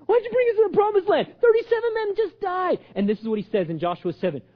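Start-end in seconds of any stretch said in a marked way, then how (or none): 0.0-2.8 drawn-up high, whining protest; whining complaint. Acted. Joshua blaming God for the deaths, whining high. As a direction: wail the grievance like a complainer. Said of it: "Stop whining!".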